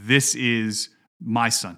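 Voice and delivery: "This is my Son" slow, stress on "This"; emphatic. Shown as a demonstration. Pastor stressing God's claim to his co-hosts, slow emphatic "This". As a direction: hit "This" hard and slow. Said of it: "it's really emphatic".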